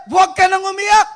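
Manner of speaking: high and loud, called out; loud comforting command. Shown as a demonstration. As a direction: cry it out to the widow. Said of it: "Sabi niya, "Huwag ka nang umiyak".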